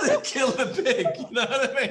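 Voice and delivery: high shrieking laugh, then words through laughter; real loud laughter; laughing hard. Real, not acted. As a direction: crack up at your own punchline. Laughing at own joke.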